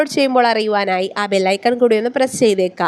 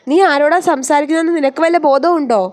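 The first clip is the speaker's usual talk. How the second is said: higher, louder scolding; raised voice; 5 semitones higher, 3 dB louder than usual. A narrator's reading. Mother scolding her son for insulting his father, voice raised. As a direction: rebuke him, voice lifted in anger. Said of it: "അവർ ശബ്ദമുയർത്തി".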